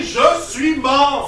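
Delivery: very slow, loud, laughing declaration; insistent shout. Acted. The son shouts to his parents that he is dead. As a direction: drag out each word as a shout.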